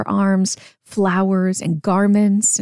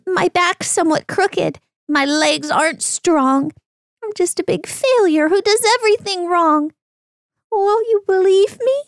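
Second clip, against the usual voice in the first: high, pitiful little donkey voice; sad donkey; 9 semitones higher than usual. Acted. Donkey pleading with the man in a high sad voice. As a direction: whimper it out as a sorry little donkey.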